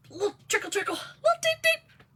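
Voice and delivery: high teary giggle; laugh-crying. Real, not acted. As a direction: squeal it through tears of laughter. Laugh-crying about the trickle.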